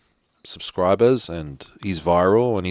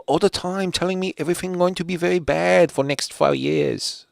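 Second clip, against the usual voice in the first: higher, broken-English voice, lightly hammed; mock Chinese accent; 7 semitones higher than usual. Joking to viewers about Professor Zhang in mock Chinese accent. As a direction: play a Chinese-accented speaker gossiping. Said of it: "imitating broken English about Professor Zhang".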